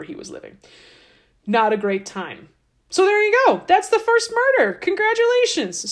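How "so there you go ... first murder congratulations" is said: sarcastic cheer. Real, not acted. Mock cheerful wrap-up.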